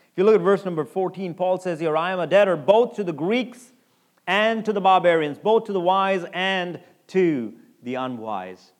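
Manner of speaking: loud, ringing sermon delivery, slowing down on the verse; preaching at full force. Acted. Preacher reading scripture with force.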